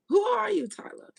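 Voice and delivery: high, loud demand; emphatic questioning. Real, not acted. Questioning Tyla during a reading, loudly demanding who she is.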